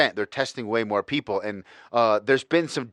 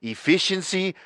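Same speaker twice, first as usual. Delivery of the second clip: a deliberately terrible German impression of cold logic; hammed-up German accent, robotic and precise; bad German accent. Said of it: "the worst goddamn German accent of all time".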